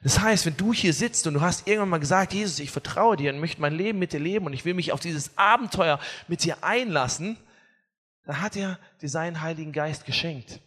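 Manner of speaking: usual speaking voice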